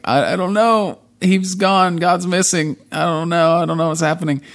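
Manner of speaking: high, panicky babbling; mock panicked fake God. Fake God cracking under Jesse's voice, high panicky babble. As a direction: babble it out, scared.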